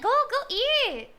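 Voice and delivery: slow, high-pitched squeal. Real, not acted. Urging friend to eat lunch.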